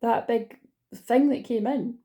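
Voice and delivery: hiccuping mid-sentence; talking through hiccups. Real, not acted. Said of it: "and I can't stop hiccuping".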